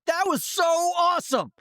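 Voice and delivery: put-on very high, loud kid's cheer; child voice, thrilled; excited kid. Kids cheering across room.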